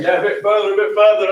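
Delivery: high, loud call of a helper guiding a car; calling out directions. Retold or imitated. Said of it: "Tara's, like, hanging out the door, saying".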